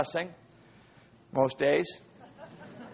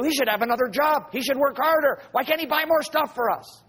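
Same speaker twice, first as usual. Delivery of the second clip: mock a spoiled teenager griping about dad; complaining teen; 5 semitones higher than usual. Preacher voices a whining child.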